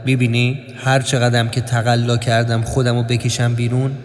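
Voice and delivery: low, level confiding voice; sombre inner narration. A narrator's reading. Narrator confides about sinking in a swamp, low sombre voice. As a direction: sink into a low, even monotone aside.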